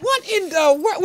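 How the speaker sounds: very high, bewildered; imitating a scared, baffled reaction high in the voice; retold fright, squeaked out in disbelief; freaked out. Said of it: "it freaked me out and scared me".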